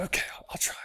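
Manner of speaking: soft, breathless whispered reply; scared whisper. Retold or imitated. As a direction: whisper it like a nervous father. Scared dad answers.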